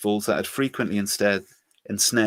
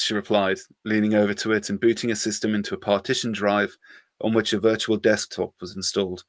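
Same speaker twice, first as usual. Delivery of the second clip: usual speaking voice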